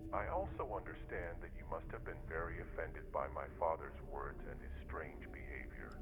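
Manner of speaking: soft, hushed, solemn reading; letter read aloud. A narrator's reading. Son writes to apologize for his father, hushed letter voice. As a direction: read the letter quietly and gently.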